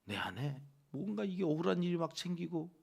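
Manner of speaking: ordinary talk, nothing marked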